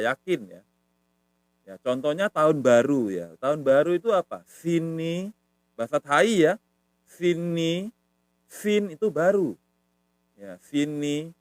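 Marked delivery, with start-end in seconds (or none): 4.4-5.3 "Sini" high and rising; "Sini" held on a high, rising tone; intonation demo. Shown as a demonstration. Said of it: "perhatiin intonasi ya". 7.1-7.9 "Sini" high, held, rising at the end; "Sini" stretched upward; intonation demo. Shown as a demonstration. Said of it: "perhatiin intonasi ya".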